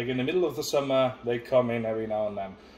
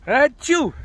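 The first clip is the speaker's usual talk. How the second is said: loud put-on sneeze; sneezing; 13 dB louder than usual. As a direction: burst out a big sneeze. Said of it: "I have to sneeze".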